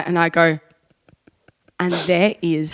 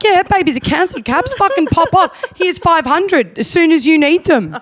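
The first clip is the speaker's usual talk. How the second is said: high-pitched mock supplier, cheering the order on; easygoing embroiderers; 9 semitones higher, 4 dB louder than usual. Imitated Edinburgh embroiderers taking a rush order, breezily keen. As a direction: wave the order through in a high voice. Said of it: "they didn't give a shit".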